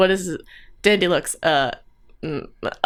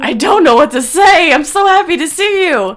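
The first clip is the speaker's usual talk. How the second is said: higher, louder, emotional gush; overwhelmed joy; 9 semitones higher, 8 dB louder than usual. Acted. Sister greets long-lost brother, overwhelmed and gushing. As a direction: let happiness spill out at raised pitch.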